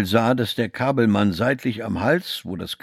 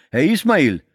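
higher-pitched call of recognition; hailing greeting; 7 semitones higher, 4 dB louder than usual. A narrator's reading. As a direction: greet an acquaintance who just turned up. Receptionist hails Ismail back.

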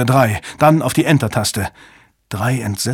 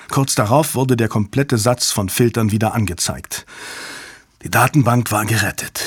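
plain reading voice

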